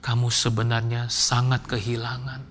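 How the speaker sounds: low, soft, measured counsellor voice; counselling lecturer. Retold or imitated. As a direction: gently explain to a grieving student. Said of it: "Terus dosen saya bilang".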